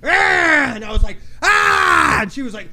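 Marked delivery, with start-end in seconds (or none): 0.0-0.7 high snarling "ah", imitating a kid's mean face; play-acted battle cry; mock fierce yell. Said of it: "Show me a mean face". 1.4-2.2 high snarling "ah", imitating a kid's mean face; play-acted battle cry; mock fierce yell. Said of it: "Show me a mean face".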